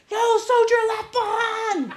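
high, slow drawn-out rally yell; screaming. Retold or imitated. Tarzan screaming at exhausted hikers, high slow rallying yell. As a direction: stretch it out like a drill sergeant. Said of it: "he's screaming over".

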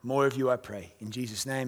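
soft, hushed closing prayer. Real, not acted. Pastor closing prayer to the church, hushed and soft. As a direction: pray it low and quiet.

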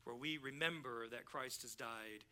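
soft, low-pitched aside, as if in church; hushed retold explanation. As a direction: explain it discreetly, keeping your voice down. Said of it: "I'm saying this as we're kinda walking down this aisle".

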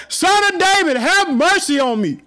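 loud, high cry for mercy; shouted plea. Retold or imitated. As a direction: call out begging. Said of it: "he shouted all the more".

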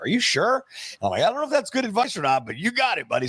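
high, incredulous "are you sure" then a bright "you got it, buddy"; stunned disbelief. Retold or imitated. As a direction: sound like the new guy who can't believe his ears. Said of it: "And I was like".

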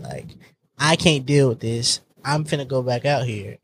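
normal talking voice

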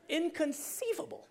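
playful impression of a film character; movie character. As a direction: do the famous movie line. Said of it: "think of is The Princess Bride".

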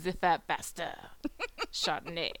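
hammed-up French pronunciation of the wine name; bad French accent. Shown as a demonstration. Podcaster jokingly gives the wine's proper pronunciation, mock French accent. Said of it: "I'm terrible with accents".

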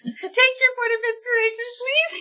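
high laughing insistence. Real, not acted. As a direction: plead through laughter.

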